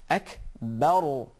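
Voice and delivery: Akbar with a heavy Ra sakin; thick sakin Ra. Shown as a demonstration. Teacher showing the thick Ra sakin in Akbar. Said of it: "you have sukoon on Ra".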